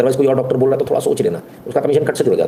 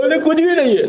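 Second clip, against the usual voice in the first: much higher pleading poor-man voice; pauper's plea. Retold or imitated. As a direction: plead poverty to the doctor, pitch way up. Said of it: "चड्डी भी फटी हुई होनी चाहिए".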